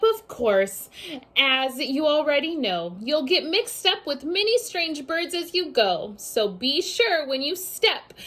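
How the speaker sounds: ordinary talk, nothing marked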